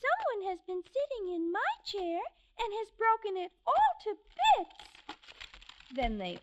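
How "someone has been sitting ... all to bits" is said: much higher, fast tiny bear; wee little voice. Acted. Baby Bear telling his parents his chair is broken, wee voice. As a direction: squeak it out in a tiny voice. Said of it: "in his wee little voice".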